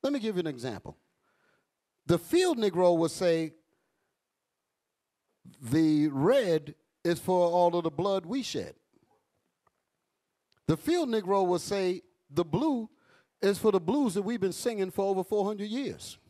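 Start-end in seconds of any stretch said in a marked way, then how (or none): 5.6-16.2 preaching. Acted. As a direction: build it like a pulpit sermon. Preaches field Negroes' view.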